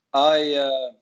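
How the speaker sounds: ordinary talk, nothing marked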